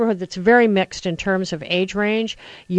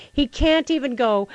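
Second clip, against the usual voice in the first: usual speaking voice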